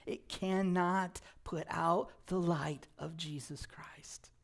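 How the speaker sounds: hushed, breathy unvoiced whisper on the promise. Real, not acted. Pastor to congregation, dropping into an awed whisper. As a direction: drop to a whisper to let it land.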